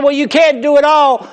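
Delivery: high, loud scoffing; doubting passerby. Retold or imitated. Passerby scoffs at the man saving starfish, high and loud. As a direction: tell him it's pointless. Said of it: "He said".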